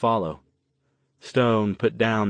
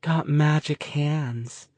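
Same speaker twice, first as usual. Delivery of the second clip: sing-song; 6 semitones higher, much slower than usual. A narrator's reading. Spacey girl continues sing-song.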